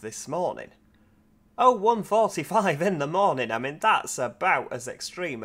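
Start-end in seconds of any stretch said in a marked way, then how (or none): 1.6-3.5 higher, louder repeat of "Oh one forty-five"; emphatic disbelief. Real, not acted. Driver telling viewers his start time, loud emphatic disbelief.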